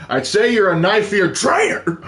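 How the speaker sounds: high, loud, drawn-out playful denunciation; hammed-up outrage breaking into laughter; mock accusation. Mock insult to a role-playing fan.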